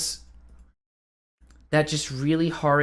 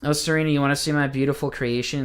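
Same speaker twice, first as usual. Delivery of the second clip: ordinary delivery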